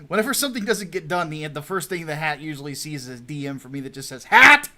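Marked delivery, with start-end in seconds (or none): 4.2-4.7 sudden loud, high shout; retold yell. Boss calling out his editor by message, sudden yell. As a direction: bellow the name in one burst. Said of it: "Even though I do yell at him a lot".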